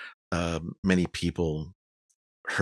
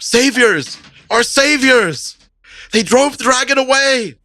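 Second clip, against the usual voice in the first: high, loud, joyful crowd shout; crowd cheering shout; 11 dB louder than usual. Acted. Townsfolk cheering the heroes from the plaza, loud joyful shouting. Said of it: "they're, like, shouting and cheering you".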